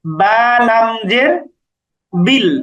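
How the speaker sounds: slow, deliberate letter-by-letter reading; slow spelling out. Shown as a demonstration. Teacher spells বিল slowly for students. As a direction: stretch out each letter for learners. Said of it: "ধরে পড়তে হবে".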